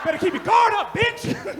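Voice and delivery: high, loud mock taunt; taunting yell. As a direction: crow it over her like a winner. Taunting wife after cheap shot.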